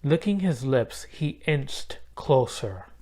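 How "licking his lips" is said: ordinary delivery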